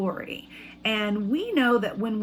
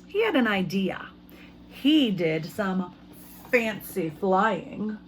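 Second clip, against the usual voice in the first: put-on reading to children; 3 semitones lower than usual. Teacher reads picture book aloud.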